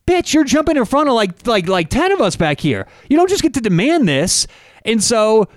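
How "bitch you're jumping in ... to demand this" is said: exasperated inner rant. Retold or imitated. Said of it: "And I'm thinking".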